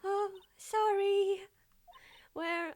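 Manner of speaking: put-on high girl voice. Voicing young girl character.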